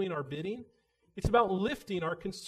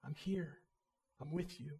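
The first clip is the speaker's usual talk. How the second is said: hushed, tender reassurance; soft comfort; 7 dB quieter than usual. Pretend. The Lord comforting us in prayer, soft and tender. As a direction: quietly soothe someone close. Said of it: "and he whispers".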